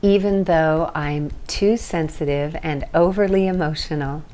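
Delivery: steady call-and-repeat affirmation voice; tapping script. Acted. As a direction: lead the group line by line for them to echo.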